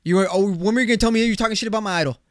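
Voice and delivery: high, loud, rapid accusation; mock outraged. Son confronts dad about his idol, mock outrage. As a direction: escalate into a fast, loud mock challenge.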